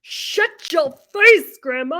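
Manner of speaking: high, slow, stretched comic insult; Sal impression. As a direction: ham up the prank show line. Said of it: "Sal is like".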